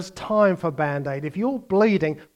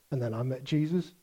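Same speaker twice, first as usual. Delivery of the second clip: much softer, flat with a small lift on Jesus; deadpan the line, bored; bland mock testimony; 7 semitones lower, 12 dB quieter, faster than usual. Preacher repeats the dull testimony, soft deadpan. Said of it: "Just simply blandly obeying".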